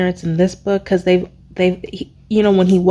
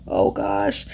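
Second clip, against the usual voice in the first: a soft whisper, higher; whispered dismay; 7 semitones higher, 6 dB quieter than usual. Real, not acted. Reader saddened by Amren's fate, whispered. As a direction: breathe it out in dismay.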